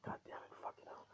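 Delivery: hushed, exasperated whisper; breath-only curse, barely voiced; whispered cursing. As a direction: swear under your breath. Hidden boy curses after parents leave.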